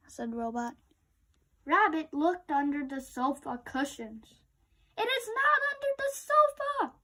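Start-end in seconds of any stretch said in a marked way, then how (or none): 5.0-7.0 high, wailing cry. A narrator's reading. Rabbit crying out while searching the sofa. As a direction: cry it out in despair. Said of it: "he cried".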